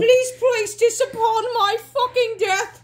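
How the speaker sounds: high, laughing mock-solemn plea; mock Victorian. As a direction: ham up the solemn request. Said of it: "I'm fucking Victorian".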